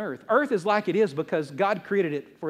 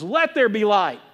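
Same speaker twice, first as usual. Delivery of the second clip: loud divine command; imitating God's booming decree, louder; 6 dB louder, slower than usual. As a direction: proclaim it like God giving an order. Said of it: "he says in a commanding voice".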